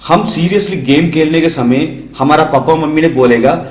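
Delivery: ordinary delivery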